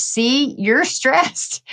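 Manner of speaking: a hint of an I-told-you-so doctor; doctor's verdict. Doctor telling his patient she is stressed. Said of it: "And he goes".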